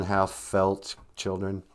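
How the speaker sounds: ordinary talk, nothing marked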